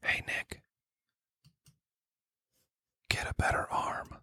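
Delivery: literal quarterback whisperer, hushed insult; mock secretive coach whisper; Kyle Shanahan whispering. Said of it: "this is my impression of Kyle Shanahan being a quarterback whisperer".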